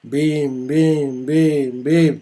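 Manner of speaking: calm, evenly spaced bims; lazy steady beats; slow beats. Shown as a demonstration. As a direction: tap them out slowly. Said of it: "tranquillement, quoi".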